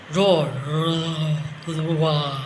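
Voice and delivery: low mock lion roar. Shown as a demonstration. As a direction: growl it out like a playful lion. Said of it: "Let's roar together like a lion".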